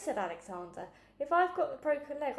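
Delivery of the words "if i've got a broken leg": eager. Acted. Alexander argues excitedly.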